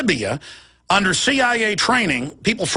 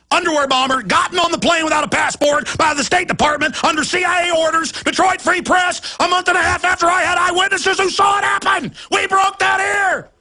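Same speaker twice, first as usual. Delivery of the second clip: furious shouting; 10 semitones higher than usual. Real, not acted. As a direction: shout out the outrage for real. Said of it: "why I'm so upset right now".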